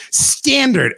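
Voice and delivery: Standard lifted high and hit hard; emphasis on standard. Shown as a demonstration. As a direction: lean hard on the word Standard. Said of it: "emphasis in the word standard".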